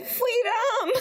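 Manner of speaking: put-on high, laughing little-kid voice; baby voice. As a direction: squeak it out like a toddler. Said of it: "in a baby voice".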